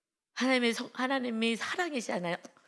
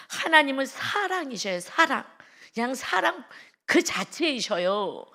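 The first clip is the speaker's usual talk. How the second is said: louder, lingering on love; emphatic preaching; 5 semitones higher, 5 dB louder than usual. Real, not acted. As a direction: declare God's love to the congregation. (하나님의 사랑을 힘주어 선포)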